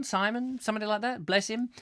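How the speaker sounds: usual speaking voice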